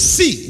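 SEE punched high and loud; stressed see. Shown as a demonstration. Preacher stressing "See" to the congregation. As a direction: lean hard on "See". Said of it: "there's an emphasis on that word".

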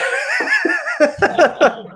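a burst of high-pitched laughter. Real, not acted. Laughs at uncomfortable remark.

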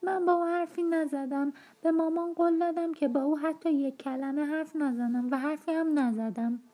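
put-on high, tearful boy's voice; a wronged boy blubbering his innocence to Cathy; sobbing. A narrator's reading. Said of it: "حق حق کنان گفت".

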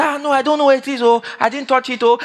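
high pleading mock denial; lightly voiced whining thief. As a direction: play the guilty boy whining innocence. Thief denying in retold story.

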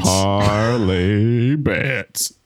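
drawn-out slow reveal; slow dramatic echo. Pretend. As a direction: stretch each word for drama.

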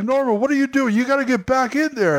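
high, urgent call into the cave; calling out to Norman. Acted. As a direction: shout up high to the actor in the suit. Calling out to the ogre actor.

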